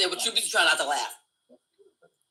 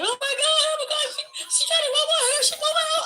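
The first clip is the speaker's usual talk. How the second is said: very high, hysterical fake crying; mock tearful screaming. Fake victim accusing a woman to the cops, mock tearful screaming. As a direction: wail out a put-on accusation. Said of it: "I had tears coming out my eyes".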